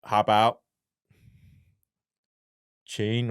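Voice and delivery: normal talking voice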